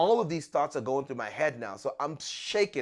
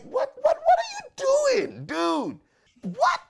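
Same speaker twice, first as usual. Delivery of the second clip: startled disbelief; 7 dB louder, slower than usual. Retold or imitated. Startled as stranger grips his hand.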